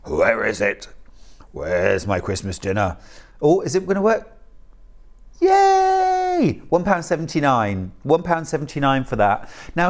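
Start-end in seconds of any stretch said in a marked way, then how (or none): 0.0-3.0 lower, grumbly little dog voice; pug voice. Acted. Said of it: "That's my pug voice". 5.4-6.6 high, loud cry of joy; excited cheer. Real, not acted. Cheers when the order system works.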